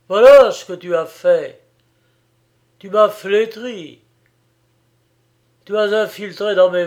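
loud, furious tirade; raging. A narrator's reading. Young man rages at the girl he blames, shouting. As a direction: hurl every word at her in rage.

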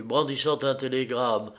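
plain reading voice

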